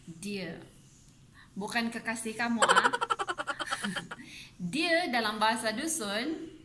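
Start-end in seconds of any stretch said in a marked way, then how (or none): 2.6-4.7 sudden high laugh at her own pun. Real, not acted. Teacher laughing at her own joke about deer.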